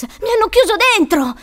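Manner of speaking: high, loud panicked complaint; desperate yell. Acted. Locked-in boy explaining to his rescuer, desperate yell. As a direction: yell that you need rescuing. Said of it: "urlò Douglas".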